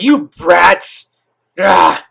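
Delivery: loud, high furious snarl from a chained fox; hammed-up angry monster yell; bitter beast roar. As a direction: spit it out like a bitter demon. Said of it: "Kurama is just bitter at this point".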